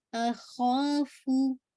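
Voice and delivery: اخافو very slow with a heavy, full خا. Shown as a demonstration. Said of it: "خا کو heavy کیجئے گا".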